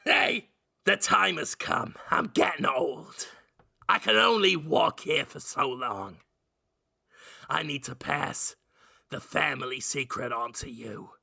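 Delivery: a hint of an aging mentor; old man voice. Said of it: "Willem Dafoe says".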